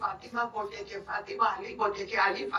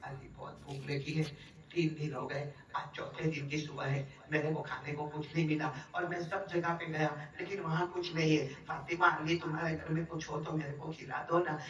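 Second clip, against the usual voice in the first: soft, low, weeping plea to his daughter; softer, lower voice of a hungry father asking for food; tearful plea; 3 semitones lower, 7 dB quieter than usual. Acted. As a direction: plead quietly through tears. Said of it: "رو کر کہنے لگے".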